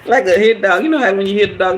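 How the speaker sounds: normal talking voice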